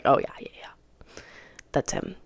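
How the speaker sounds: very low, near-whispered aside; hushed realization. Retold or imitated. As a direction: whisper it to yourself as it sinks in. Retells recognizing ex, to herself.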